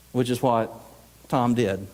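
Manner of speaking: ordinary talk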